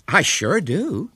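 high, drawn-out emphatic assertion; determined American lady; assertive. A narrator's reading. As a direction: insist firmly, slowly, pitched high. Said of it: "asserted Miss Burford".

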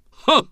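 a sharp, high, scoffing huh; one short mocking laugh; short ironic laugh. A narrator's reading. William scoffs at his mother with a short ironic laugh. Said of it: "his short, ironic laugh".

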